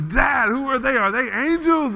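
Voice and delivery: put-on child voice full of wonder; little boy's voice. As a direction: ask it wide-eyed as a little boy. Little boy asks his dad.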